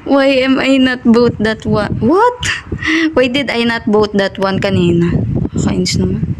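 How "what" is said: startled what. Real, not acted. Reacts to being killed.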